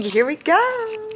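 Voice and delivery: high, drawn-out launch; excited kickoff. Real, not acted. Reader starting King, excited high kickoff. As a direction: stretch it out high as you dive in.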